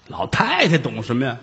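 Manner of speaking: ordinary delivery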